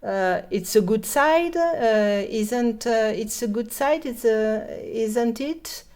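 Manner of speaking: English with an effortless French accent; thick French-accented English. Shown as a demonstration. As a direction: read English as a French speaker would, no effort at English sounds. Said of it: "je fais un accent français".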